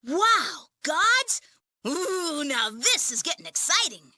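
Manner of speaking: eager, thrilled voice; excited. Acted. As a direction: gush with childlike excitement. Thrilled at news of gods.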